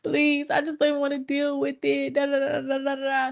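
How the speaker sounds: high, strained pleading through tears; crying plea. Retold or imitated. Crying daughter begging her mother to let it go, high tearful plea. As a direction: beg mom to drop it, voice high. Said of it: "like, crying".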